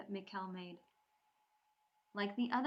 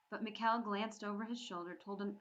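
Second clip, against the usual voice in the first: ordinary delivery, nothing marked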